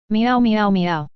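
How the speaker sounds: slow, drawn-out meowing like an upset cat; mock cat yowls; cat meows. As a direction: meow slowly like a cat in protest. Cat protests being neutered.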